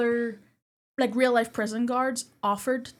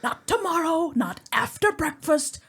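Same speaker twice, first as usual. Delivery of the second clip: raised, emphatic film quote; acted stern order; 6 semitones higher, 4 dB louder, slower than usual. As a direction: pitch up and deliberate, like an order. Acting out film's barked order.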